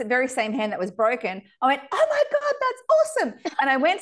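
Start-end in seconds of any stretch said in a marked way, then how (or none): 1.9-3.5 much higher and louder, delighted gush; thrilled squeal. Retold or imitated. Guest reenacting her reaction to the doctor, thrilled and squealing. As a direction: gush like a broken hand is the best news ever.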